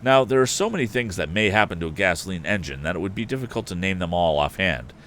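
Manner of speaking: ordinary delivery, nothing marked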